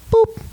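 high squeaky boop; playful boop. Acted. Pastor punctuates a point to his congregation, squeaky boop. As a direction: pop a tiny high boop sound.

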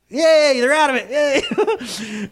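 high, loud laughing yay; joking cheer at the drowsy congregation; mock cheer. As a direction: tease the crowd with fake celebration. Mock cheer at tired listeners.

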